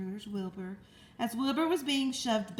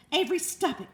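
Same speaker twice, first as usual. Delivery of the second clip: higher, louder scolding call; mother crying out; 7 semitones higher, 6 dB louder than usual. Acted. Mother scolding Avery clowning in ring, loud call. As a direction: stop the boy now. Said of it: "cried his mother".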